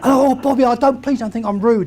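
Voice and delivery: high, loud, apologetic Indian-English voice; hammed-up Hinglish accent; imitated Indian accent. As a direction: plead politely in a put-on Indian accent. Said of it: "I found myself talking like this".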